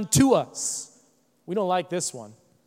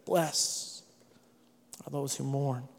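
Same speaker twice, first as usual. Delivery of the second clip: soft closing blessing; 8 semitones lower, 9 dB quieter, slower than usual. Real, not acted.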